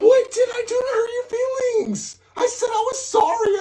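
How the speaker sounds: shouted through tears, pitch jumping up; cry-yell the question in real distress; crying out in anger mid-fight. Real, not acted. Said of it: "Why are you yelling?".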